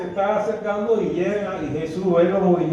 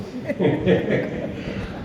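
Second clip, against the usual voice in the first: 1 breathy laugh; 3 semitones higher, 4 dB quieter than usual. Real, not acted. Laugh after mock quote.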